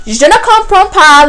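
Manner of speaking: high, loud put-on French accent; blurting French in exasperation; mock French. Teller to two guys on the street, loud high mock French. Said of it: "I'm like".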